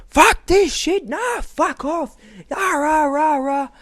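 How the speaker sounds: high, strained screaming rant, imitated; going ballistic. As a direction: imitate a ballistic rant that breaks into ranting noises. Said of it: "just top of someone's lung just going ballistic".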